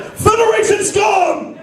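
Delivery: high, slow hammed-up Klingon war cry; shouted mock Klingon threat over laughter; Klingon warrior. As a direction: bellow a threat like a hammy Klingon. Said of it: "he'll be like".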